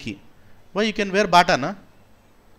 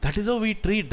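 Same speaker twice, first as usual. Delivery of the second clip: ordinary talk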